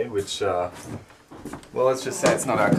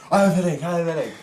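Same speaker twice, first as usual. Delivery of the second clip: higher, louder mock of her broken English; manageress impression; 7 semitones higher, 5 dB louder, faster than usual. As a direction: parody her whining excuse. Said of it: "That's a good impression".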